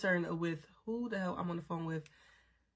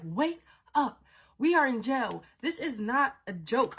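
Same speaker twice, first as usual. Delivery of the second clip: exasperated scolding; 5 semitones higher, 3 dB louder, slower than usual. Retold or imitated. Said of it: "I'm livid at this point".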